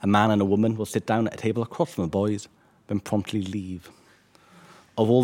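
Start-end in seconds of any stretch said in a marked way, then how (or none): none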